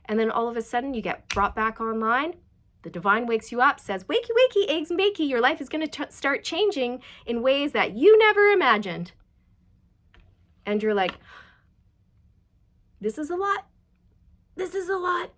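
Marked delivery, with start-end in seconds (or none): 4.0-9.1 high, loud sing-song wake-up call; divine wake-up call. Retold or imitated. The divine wakes you up. 13.0-15.3 high, put-on overwhelmed voice; overwhelmed masculine. And you're like.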